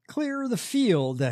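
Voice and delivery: slow, high mock sermon line; imitating preacher. Mocking the TV preacher's catchphrase, slow preachy voice. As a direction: drag it out like a preacher. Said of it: "he enunciates like Joel Webben".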